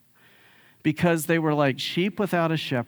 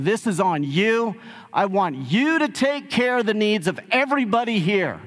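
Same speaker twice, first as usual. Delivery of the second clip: "you" punched higher in a retold command; insistent imitated order, stressing "you"; emphatic YOU; 6 semitones higher than usual. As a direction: hit "you" hard, pitch lifted, putting it on them. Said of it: "is very emphatic".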